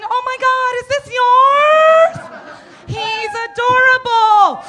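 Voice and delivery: high, loud gushing squeal; squealing excitement. Retold or imitated. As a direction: gush over it like a cute puppy. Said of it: "I'll come running right up to it going".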